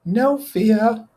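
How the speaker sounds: put-on timid high-pitched boy; squeaky nervous kid; high boyish voice.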